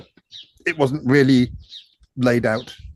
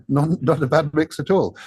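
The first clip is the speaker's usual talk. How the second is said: ordinary talk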